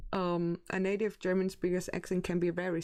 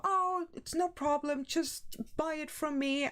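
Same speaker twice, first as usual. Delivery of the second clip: lightly voiced obliging inspector, higher pitch; ticket inspectors; 8 semitones higher than usual. Inspector reassuring a supposed tourist, lightly imitated and higher. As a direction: quote them easygoing, pitched up. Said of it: "And they were".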